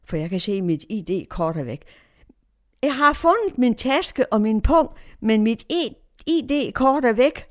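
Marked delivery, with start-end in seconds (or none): 2.8-7.5 higher, louder report called out; retold shout. Woman tells Ibrahim her ID card is gone, retold shout. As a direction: call the news across to a neighbour. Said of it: "og jeg råber".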